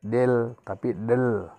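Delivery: del said twice, the e barely voiced as a short schwa; pronunciation contrast. Shown as a demonstration. Teacher shows learners how del sounds, slow and careful. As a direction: contrast del with del, slowly and deliberately. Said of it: "Walaupun ada E di sini, itu tidak dibaca".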